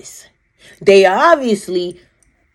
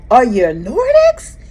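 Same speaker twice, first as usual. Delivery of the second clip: slow eager question, climbing much higher on the repeat; excited question; 6 semitones higher, 5 dB louder than usual. Retold or imitated. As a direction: ask it breathless with wonder, pitch jumping up. Retelling asking the being.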